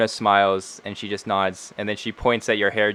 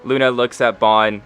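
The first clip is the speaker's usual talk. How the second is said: ordinary talk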